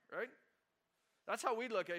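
normal talking voice

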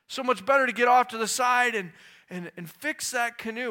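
loud, high preacher build; full-force sermon climax; fiery preaching. Acted. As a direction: preach it to the rafters.

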